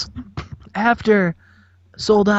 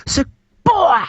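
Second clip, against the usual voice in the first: hammed-up rapper intro, pitched way up; 3 semitones higher than usual. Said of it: "Low D's like".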